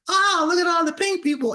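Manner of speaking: high, loud comic outcry; mock exclamation. Comedian recalling a line from his bit, exclaiming. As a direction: pitch it way up like a hammed-up onlooker.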